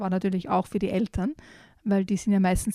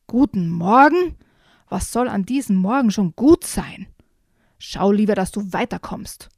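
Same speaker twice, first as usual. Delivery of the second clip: louder, gruff, slowed grumpy retort; unfriendly; 5 dB louder than usual. Acted. Badger snaps at the friendly raccoon, louder gruff retort. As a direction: throw his greeting back at him, louder and gruff. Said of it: "sagte der Dachs unfreundlich".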